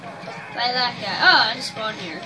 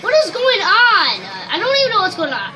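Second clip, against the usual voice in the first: loud confused outburst, laughing at the end; raging gamer; 6 semitones higher, 7 dB louder than usual. Real, not acted. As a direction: blurt it out in disbelief. Chaotic fight, confused gamer.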